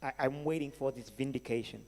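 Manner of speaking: usual speaking voice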